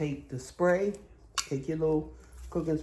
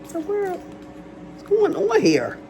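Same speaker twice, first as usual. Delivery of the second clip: high mock scold; 7 dB louder, much slower than usual. Mock exasperated aside to child.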